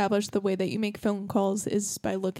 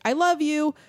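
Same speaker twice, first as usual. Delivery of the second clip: raised, louder acted sign-off; imitated parent, pitched up; retold mom's goodbye; 8 semitones higher, 6 dB louder than usual. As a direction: call it out as a mom into a phone. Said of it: "she's like".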